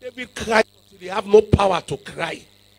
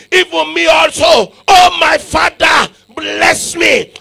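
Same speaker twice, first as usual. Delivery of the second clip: loud, high, pleading shout with a catch of crying; Esau's bitter plea; 7 semitones higher, 7 dB louder, slower than usual. Acted. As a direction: beg the father like Esau, crying out. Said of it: "That was the kind of cry he cried".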